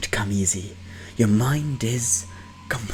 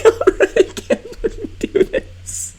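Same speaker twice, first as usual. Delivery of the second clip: laughing, high and breathless; 5 dB louder, slower than usual. Real, not acted. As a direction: giggle through the words. Breaks down laughing at the scene.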